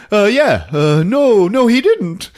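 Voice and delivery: high, loud, flustered backpedalling; nervous stammering. Retold or imitated. Ralph nervously stammers along.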